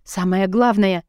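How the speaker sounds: ordinary delivery, nothing marked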